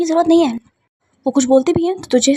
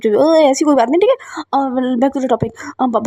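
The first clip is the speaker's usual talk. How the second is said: usual speaking voice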